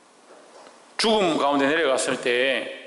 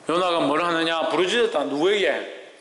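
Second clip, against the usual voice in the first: ordinary delivery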